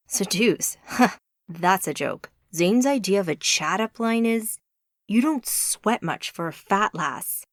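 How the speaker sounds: lightly voiced American guy, mocking; a hint of a scoffing friend with a laugh. A narrator's reading. Friend mocks the gargoyle's chat-up lines, mild American voice. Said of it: "said a man with a softer American accent".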